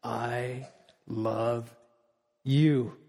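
low, soft and slow; gentle divine love. Pretend. God answering the worried believer, low and gentle. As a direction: answer the plea quietly, with calm assurance. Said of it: "And God says".

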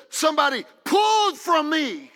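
loud, high shout of discovery; Jesus crying out. Acted. Preacher voicing Jesus as someone touches him, loud high shout. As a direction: shout it, startled, to the crowd. Jesus feels power leave.

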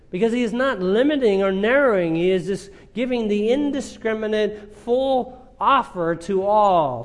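high, loud preaching, slowing on the key phrases; fervent preaching. Acted. Preacher to congregation on God's open offer, full-force preaching. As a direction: proclaim the offer to everyone.

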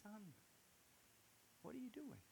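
a father gently calling his son back; voice of God. Retold or imitated. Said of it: "I just hear God said".

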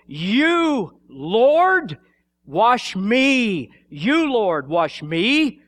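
you and me each stretched out, high and loud; incredulous stress on YOU and ME. Retold or imitated. Peter questions Jesus washing his feet, heavy stress on you and me. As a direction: lean long and loud on "you" and "me". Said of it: "In the original language, this is emphasized".

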